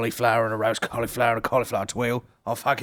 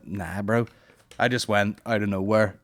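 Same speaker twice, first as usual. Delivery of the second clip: normal talking voice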